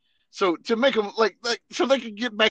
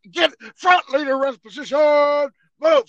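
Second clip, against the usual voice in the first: loud, raised drill sergeant order; drill command; 8 semitones higher, 10 dB louder than usual. Retold or imitated. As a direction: order them down like a real sergeant. Fake sergeant smoking privates.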